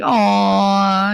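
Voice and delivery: long, drawn-out gushing "Aw"; doting mother. Retold or imitated. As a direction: gush it like a proud mom. Mother gushing over son's accent.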